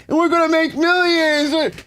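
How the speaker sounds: high, squeaky, gushing parody of the pitch; mocking friend. Parodying the friend promising millions, squeaky gushing voice. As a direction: ham up the naive dreamer.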